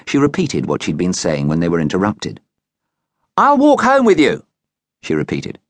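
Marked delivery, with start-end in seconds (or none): 3.4-4.4 put-on bossy girl, high and louder. A narrator's reading. Bossy girl insisting on walking a classmate home, bossy and loud. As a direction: lay down the law like a bossy schoolgirl. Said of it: "Using her bossiest voice".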